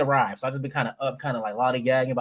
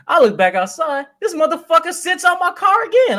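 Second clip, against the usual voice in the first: outraged; 11 semitones higher, 5 dB louder than usual. Real, not acted. Outraged retelling, loud high.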